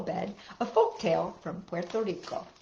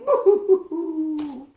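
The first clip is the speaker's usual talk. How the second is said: higher, slow pretend sobbing; mock crying; 7 semitones higher, 4 dB louder, slower than usual. Showing the picture, voicing the boy's pretend crying. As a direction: stretch out a playful boo-hoo.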